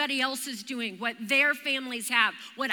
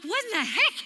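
retold fright; 7 semitones higher than usual. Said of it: "I'm out of breath because it scared me".

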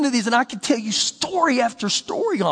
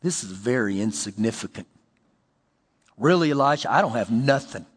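very low, subdued voice; understated, defeated murmur of a poor widow; downcast widow; 14 semitones lower, 4 dB quieter than usual. Acted. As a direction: sink low, as if it hardly counts. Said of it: "in her eyes, you know what she's saying".

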